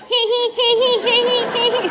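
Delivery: high goofy hee-hee giggling like a clown; silly high giggle. Retold or imitated. Clowning through the waiting room, high silly giggle. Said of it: "honking boobs like".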